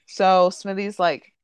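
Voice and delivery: usual speaking voice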